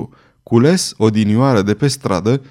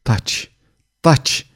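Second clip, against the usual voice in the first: snapping. A narrator's reading. Queen silences the stammering astrologer, snapped with some restraint. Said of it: "se răstia".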